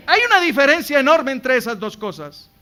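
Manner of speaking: preaching at full force. Acted. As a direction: drive it home to the congregation. (predicación a todo pulmón)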